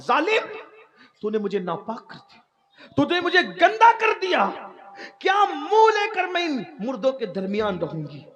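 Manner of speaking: high-pitched, impassioned accusing cry; retold scream. Dead girl accuses the man who defiled her, retold scream. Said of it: "اس نے چیخ ماری".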